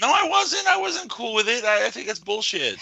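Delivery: high-pitched, loud indignant denial; squealed objection; indignant protest. Real, not acted. Heated denial of being excited.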